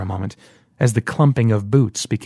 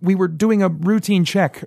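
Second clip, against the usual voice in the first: much higher, nervous rush; nervous stammer; 8 semitones higher than usual. A narrator's reading. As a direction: cover your fear, talking fast. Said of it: "Rand stammered nervously".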